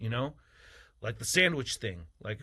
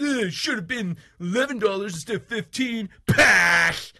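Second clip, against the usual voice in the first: higher, louder mocking yell ending in a scoff; mock whiny haggler; 7 semitones higher, 8 dB louder than usual. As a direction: ham up a cheapskate shouting down the price. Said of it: "goes to the store and says".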